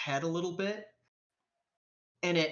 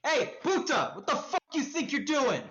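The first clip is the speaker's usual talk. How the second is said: high, quick-fire confrontational street voice; angry challenge; 8 semitones higher than usual. Acted. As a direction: call out sharply at a stranger behind you. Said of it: "you hear a voice behind you".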